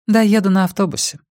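quick, high feminine character voice; reply briskly in a high woman's voice. A narrator's reading. Woman telling Rex her plans, quick high voice. Woman answers Rex's question.

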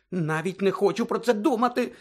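high-pitched anguished groan; anxious moan. A narrator's reading. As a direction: moan it in dread. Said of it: "простогнала Герміона". (стривожений стогін)

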